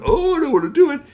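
very high, loud whining; whiny groan. Acted. Family groaning about chores, very high and loud. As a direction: groan like a lazy kid.

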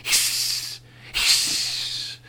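whispered hiss of angry squirrels. Acted. Squirrels hissing at Curtis, soft unvoiced hiss. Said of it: "they were all hissing".

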